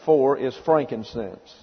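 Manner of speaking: ordinary delivery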